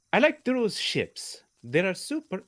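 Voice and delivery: high, loud, slow mock Scottish accent that slides Italian; attempted Scottish accent. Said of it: "Scottish accent".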